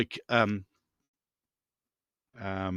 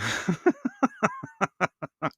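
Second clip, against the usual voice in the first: laugh; 7 semitones higher than usual. Real, not acted. Laughing at his own joke.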